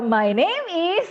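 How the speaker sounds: "My name is" high and loud, "is" climbing; rising intonation. Shown as a demonstration. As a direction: exaggerate the upswing on "is".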